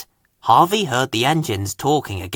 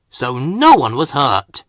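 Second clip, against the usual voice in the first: plain reading voice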